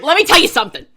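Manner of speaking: shouted Chicago accent impression. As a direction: belt it like a Chicago tough guy. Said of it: "accent was, like, literally, like, from Chicago".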